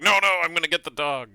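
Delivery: high, insistent character voice; stubborn movie character. Pretend. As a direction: dig in and refuse. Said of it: "That one's like".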